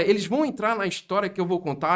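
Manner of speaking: ordinary delivery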